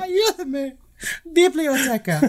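very high, playful Spanish. Pretend. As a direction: squeak out Spanish words for laughs. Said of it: "go up into a higher octave".

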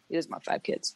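hushed, voiceless retelling of Mary Foley's line; whispering Mary Foley's warning to the podcast cohost. Retold or imitated. Retelling a whispered warning about kids. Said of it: "she goes".

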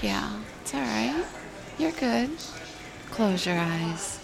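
sexy sleepy radio voice. Shown as a demonstration. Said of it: "Let me change that voice just a little bit more".

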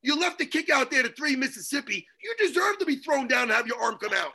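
ordinary talk

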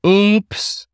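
high, mocking little oops; facetious oops. A narrator's reading. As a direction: fake an innocent oops with a sneer. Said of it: "let out a facetious".

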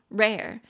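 rare said as a single syllable, RAIR; teacher models rare as one clean syllable for her listeners. Shown as a demonstration. Teacher says rare as one syllable. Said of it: "就一个音节，rare".

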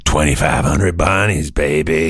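slow, low, drawn-out artist drawl; cool artist voice. Pretend. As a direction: play the smug artist, lay it back slow. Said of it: "I'll be the artist".

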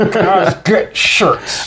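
imitating the singer. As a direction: ham up the star's rant through laughter. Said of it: "he's yelling that the guys wear shirts".

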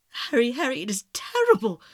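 loud, distressed exclamation; anguished cry. A narrator's reading. The lad cries to Harry over a death, anguished. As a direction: cry out to a friend in horror. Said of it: "cried the lad".

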